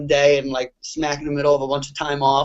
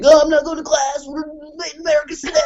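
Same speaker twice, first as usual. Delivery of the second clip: mock college kid; 11 semitones higher, faster than usual. Mocking college students skipping class.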